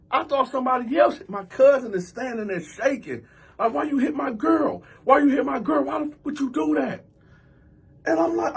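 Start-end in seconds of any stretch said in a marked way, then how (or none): 3.7-7.0 high, upset, repeated demand; mock outrage. Shaking cousin confronts him about his girl, high indignant protest. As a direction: imitate an indignant cousin, voice pitched up.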